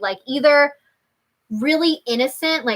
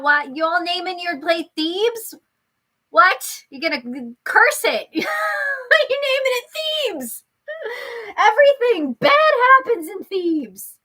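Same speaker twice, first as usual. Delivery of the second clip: high-pitched incredulous outcry, laughing; mock alarm; 11 semitones higher than usual. Real, not acted. Streamer scolding viewers for naming islands Thebes, playfully alarmed. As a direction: exclaim in amused disbelief, pitch way up.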